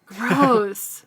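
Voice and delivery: loud, high disgusted gross. Real, not acted. Friend echoing the verdict on blood purity. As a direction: spit it out in disgust.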